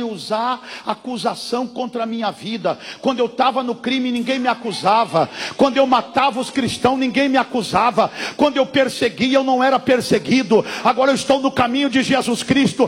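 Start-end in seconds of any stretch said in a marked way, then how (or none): 3.0-12.9 loud, driving sermon as Paul; preacher speaking as Paul against accusation; fiery preaching. Acted. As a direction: build it to a shout. Preaching at full force.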